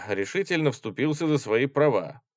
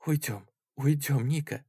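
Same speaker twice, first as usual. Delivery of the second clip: high, anxious entreaty; pleading; 6 semitones higher than usual. A narrator's reading. Olga begs her brother to leave, pleading. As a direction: beg gently without raising volume. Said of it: "молила Ольга Полежаева".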